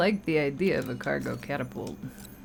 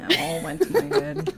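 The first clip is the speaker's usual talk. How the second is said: laughing; 12 semitones higher, 5 dB louder than usual. Real, not acted. Laughing echo of friend's joke.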